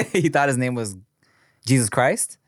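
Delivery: usual speaking voice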